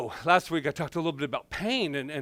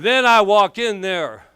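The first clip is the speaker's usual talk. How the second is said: loud dramatic entrance; 5 semitones higher, 12 dB louder, slower than usual. Retold or imitated. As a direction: belt it out high and loud, like a big stage entrance. Dramatic entrance, told loudly.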